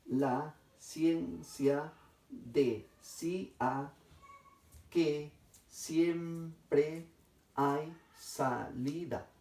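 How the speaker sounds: very slow, each syllable set apart. Shown as a demonstration. Teacher showing students how to separate syllables, slow and segmented. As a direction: break the sentence into separate syllables with pauses. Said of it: "voy a separar de la siguiente manera".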